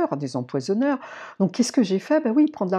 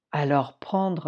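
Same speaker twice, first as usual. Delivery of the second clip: normal talking voice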